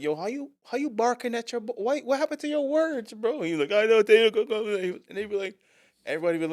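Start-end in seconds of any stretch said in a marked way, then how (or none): 0.0-3.4 high-pitched, mock incredulous questioning; imitated baffled coworkers. Coworkers asking the Barbadian about his words, high mock disbelief. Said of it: "they used to be like". 3.7-4.8 high, louder garbled Barbadian imitation; mock Barbadian accent. Barbadian coworker answering baffled colleagues, high mock Bajan accent. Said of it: "nobody could understand his accent".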